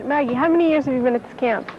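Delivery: ordinary talk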